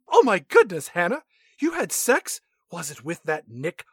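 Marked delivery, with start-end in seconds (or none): 0.1-2.4 high, shocked gasp; shocked mom. Pretend. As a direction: gasp at the news.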